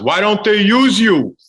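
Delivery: high, slow, loud mock fan; pushy fan. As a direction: ask it like a nosy stranger. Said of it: "the one I always got was".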